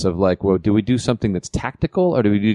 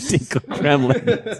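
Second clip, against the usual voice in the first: laughing; 5 semitones higher, slower than usual. Real, not acted. Laughing repeat of joke name.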